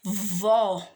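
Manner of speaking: "vó" alone, held and firm; isolated syllable. Shown as a demonstration. Teacher sounding out the second syllable to a class, isolated syllable. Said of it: "Segunda sílaba".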